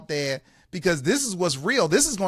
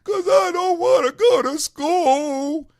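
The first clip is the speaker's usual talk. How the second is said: high, loud, slow mock wail; imitating a child's drawn-out whine; crying six-year-old; 12 semitones higher, 5 dB louder, slower than usual. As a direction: bawl it like a little boy. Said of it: "He's crying".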